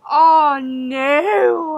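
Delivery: loud, drawn-out oh no; startled exclamation. Real, not acted. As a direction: blurt it out loud and high. Startled by a sudden door noise.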